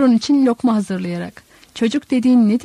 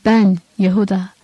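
usual speaking voice

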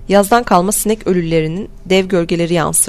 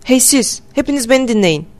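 higher-pitched call for attention, mildly roared; 5 semitones higher than usual. A narrator's reading. Norton to the whole crowd, restrained roar. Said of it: "Norton kükredi".

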